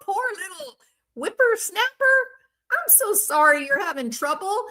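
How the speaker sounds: put-on old lady voice. Future old self pitying a YouTuber, high sweet granny voice. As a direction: croon it like a kindly granny. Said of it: "And I say".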